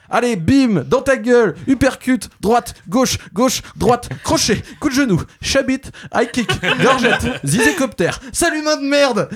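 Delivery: cris de combat aigus et rigolards; enchaîner les coups comme un commentateur de catch; bagarre mimée surexcitée. Pretend. Bagarre mimée contre l'humanité. (bagarre pour rire, voix aiguë et excitée)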